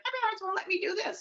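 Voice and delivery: put-on high, quick child-like whine; complaining kid. Kid grumbling about parents who said no, high quick whine. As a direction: pitch it way up and gabble the complaint.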